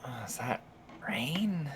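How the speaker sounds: soft, unvoiced murmur of a question; waking whisper. Waking in the tent to rain, whispered wondering. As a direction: whisper it as you wake. Character wakes, hears rain.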